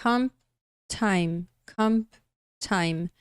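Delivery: "comp time" with the P almost gone; pronunciation demo. Shown as a demonstration. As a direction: say "comp time" twice, P fading into the T. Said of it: "Tá vendo aí a pronúncia?".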